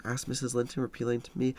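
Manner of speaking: ordinary delivery, nothing marked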